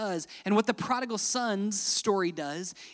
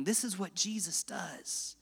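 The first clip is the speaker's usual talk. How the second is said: hushed aside; 8 dB quieter, slower than usual. Real, not acted.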